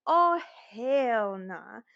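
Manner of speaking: slow, stretched-out sassy refusal; slowed sassy hell nah; drawn-out hell nah. Retold or imitated. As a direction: drag it out, unimpressed. Retold refusal.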